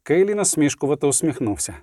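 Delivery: plain reading voice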